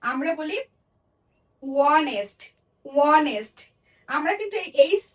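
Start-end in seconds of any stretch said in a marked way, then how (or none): 1.6-3.6 ওয়ানেস্ট with silent h, no h sound. Shown as a demonstration. Teacher demonstrating silent h, ওয়ানেস্ট. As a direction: say ওয়ানেস্ট starting on the vowel. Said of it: "আমরা কিন্তু হনেস্ট বলি না, আমরা বলি".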